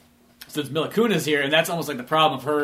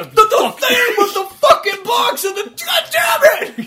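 very high, loud, slow laughing screech; mock exasperated shriek; 6 dB louder, slower than usual. Pretend villain explaining a TV to a grunt, high laughing shriek. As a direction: lose it trying to explain a TV.

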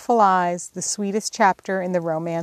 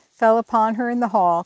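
ordinary delivery

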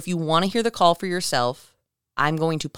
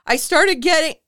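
mock crying; 12 semitones higher, 7 dB louder than usual. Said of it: "you can hear the man crying".

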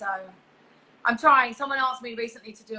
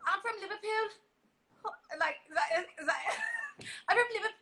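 attempted Scouse accent; 9 semitones higher than usual. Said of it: "Scouse accent".

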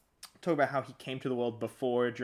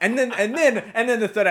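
loud laughing restart; 10 semitones higher, 12 dB louder than usual. Real, not acted. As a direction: laugh through the words. Laughing mid sentence.

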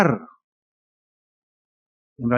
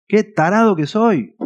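higher, slower, hammed-up moan; mock self-pity; 8 semitones higher than usual. Preacher mimics an unfaithful husband caught out, mock moan. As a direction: play the caught husband groaning at himself. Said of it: "están quebrantados".

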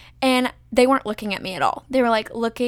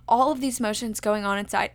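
ordinary talk

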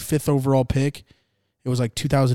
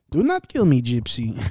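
Borat. Acted. Quoting a funny film to a friend, mock Kazakh accent. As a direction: plead in a hammed-up comic foreign accent. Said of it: "Borat's so funny".

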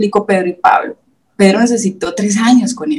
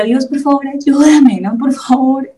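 retold desperate prayer; 6 semitones higher, slower than usual. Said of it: "Y yo le decía".

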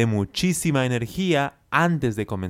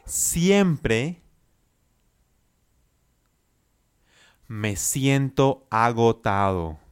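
slow chunked phrase; slower than usual. Shown as a demonstration. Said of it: "Repite después de mí".

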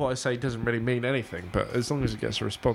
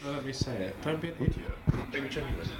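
quiet stern; 2 semitones higher, 5 dB quieter than usual. Real, not acted. Friend quietly scolds him for interrupting the take.

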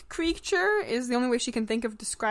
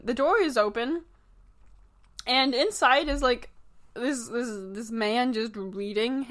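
ordinary delivery

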